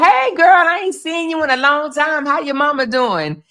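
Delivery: high, bubbly catch-up chatter; chatty neighbour greeting. Retold or imitated. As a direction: gush like you ran into an old friend. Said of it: "You can't be like".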